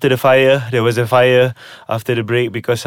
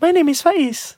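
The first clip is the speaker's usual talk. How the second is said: put-on high falsetto girl voice; man doing a woman's voice. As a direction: squeak it out like a girl. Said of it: "that's another girl's voice".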